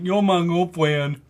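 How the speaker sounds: mock the man's slurred, clumsy claim; mimicked pestering customer. Imitating a customer pestering the shop girls, put-on voice. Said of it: "walk up to all the girls ... and be like".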